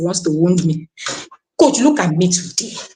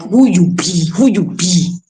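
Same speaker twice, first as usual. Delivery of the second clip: cocky boast; 2 semitones lower, slower than usual. Retold or imitated. Bursting with confidence, she mock-challenges her critics in a swagger. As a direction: toss it out like a proud challenge. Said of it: "My confidence is coming out of my ear, like".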